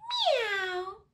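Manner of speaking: high, stretched meow mid-question; playful high kitty sound; cat meow. Acted. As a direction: mew the word like a cat. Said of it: "says cat".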